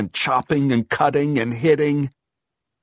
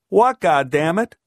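higher, louder exasperated question; irritated; 7 semitones higher, 6 dB louder than usual. A narrator's reading. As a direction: demand it with a cursing edge. Tense reply to her repeated call.

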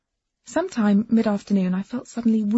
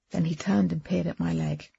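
ordinary delivery

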